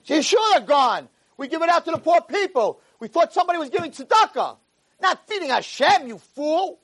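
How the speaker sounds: high, indignant yelling; acted exasperated rabbi; angry scorn. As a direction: set the fool straight with contempt. Rabbi scornfully explains, insults him.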